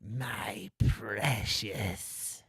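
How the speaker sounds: slow raspy whispered Gollum. Shown as a demonstration. Host gives a movie quote clue in a slow whispered Gollum voice. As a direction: hiss it out slowly like Gollum. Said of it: "I can say it with some inflection".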